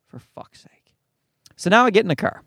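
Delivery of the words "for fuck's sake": whispered, exasperated curse; exasperated aside. Acted. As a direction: curse under your breath, barely audible.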